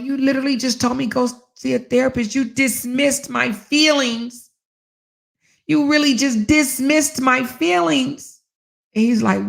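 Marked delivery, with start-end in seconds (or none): 0.0-8.4 indignant accusation. Retold or imitated. Accusing husband.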